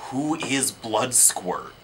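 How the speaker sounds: slow, mock-ominous teaser; playful cliffhanger delivery; mock-mysterious teaser. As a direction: draw out the mystery in jest.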